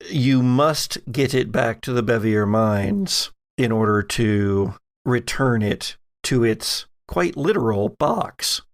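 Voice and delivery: ordinary delivery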